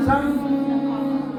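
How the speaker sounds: सं- held on a humming ng; vibrating nasal. Shown as a demonstration. Teacher asks if they feel vibration, held nasal hum. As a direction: let सं- ring on in the nose. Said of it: "vibration हो रही है".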